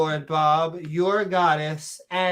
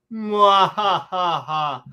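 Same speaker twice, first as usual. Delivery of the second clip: slow drawn-out villain cackle; evil laugh; much slower than usual. Pretend. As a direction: cackle slowly like a cartoon villain. Villain laugh after the boast.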